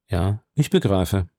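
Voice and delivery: a hint of a grave nobleman; composed count. A narrator's reading. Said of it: "sagte der Graf".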